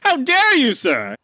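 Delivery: high, stammering outrage; splutter, then rise to a high huffy how dare you; high offended merchant; sputtering indignation. Acted. Merchant accused of fraud.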